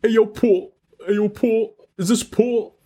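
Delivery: put-on high-pitched silly New York accent; mock New Yorker on a prank call; weird New York accent. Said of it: "a, a weird accent".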